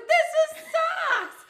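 very high, loud protest; mock indignant squeal. Introducing her date to angry friends, high mock indignant squeal. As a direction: squeak it out in exaggerated defence. Retells defending the man, pitched up.

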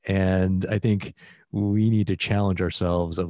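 ordinary delivery

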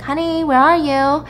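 lightly acted searching call. Wife looks for her husband at the site, calling out.

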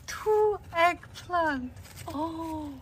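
high, loud, slow exclamation; excited delight. Real, not acted. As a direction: savour the find out loud. Finding eggplants.

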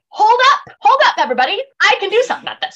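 high, calling out to stop everyone; mock-heroic interjection. Vale interrupts to save the day.

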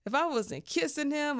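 ordinary talk

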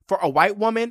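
high, loud punch on woman; emphatic. Real, not acted. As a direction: hit "woman" hard and high. Emphatic praise of [name].

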